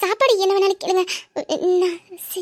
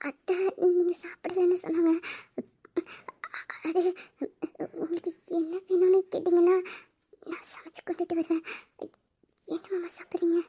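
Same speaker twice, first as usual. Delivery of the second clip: hushed, bashful offer of food; shy whisper; 8 dB quieter than usual. Acted. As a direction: whisper it shyly to her husband. Shy wife offering food quietly. (வெட்கத்துடன் கிசுகிசுப்பு)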